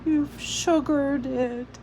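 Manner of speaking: slow, high, tear-choked words; tearful. A narrator's reading. Miss Smith to Ada over the tea, tearful and slow. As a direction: speak through fresh tears, slowly. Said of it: "fresh tears sprang to her eyes".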